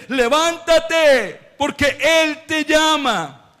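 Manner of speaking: high, very slow, drawn-out call; proclaimed summons. Acted. Preacher voicing the call to Bartimeo, loudly proclaimed. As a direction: stretch the command out like a proclamation.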